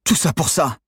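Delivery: high, loud exasperated outburst; worked-up. A narrator's reading. Trapped accomplice cursing their failure, worked-up outburst. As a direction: rage at the wasted effort. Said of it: "s'énerva Maxime Prieur".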